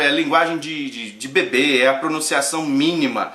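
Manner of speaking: ordinary talk, nothing marked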